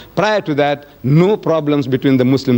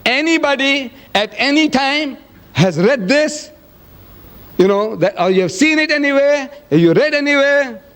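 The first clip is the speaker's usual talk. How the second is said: calling out to the hall; 11 semitones higher than usual. Retold or imitated. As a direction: pitch it up and stretch it out to reach the back rows. Chairman questions six thousand people.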